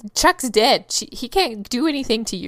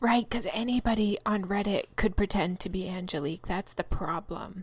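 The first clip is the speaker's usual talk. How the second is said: whispering; 3 semitones lower, 7 dB quieter than usual. Real, not acted. Unvoiced whisper.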